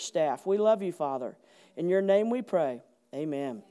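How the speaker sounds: soft, subdued pastoral prayer. Real, not acted. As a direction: pray quietly, letting the line endings sink. Leads the congregation in prayer.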